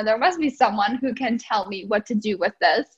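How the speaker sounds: ordinary talk, nothing marked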